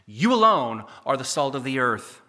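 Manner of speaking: "you alone" stressed, loud and firm; firm, loud emphasis on "you alone"; emphatic you alone. Shown as a demonstration. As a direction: hit "you alone" hard. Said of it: "It's emphatic in the Greek".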